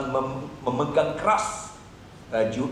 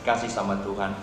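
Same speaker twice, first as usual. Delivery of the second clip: normal talking voice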